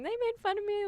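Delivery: high, small mock-crying voice; imitating tearful child. Mother retelling her crying daughter's complaint, mock tearful. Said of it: "she was crying".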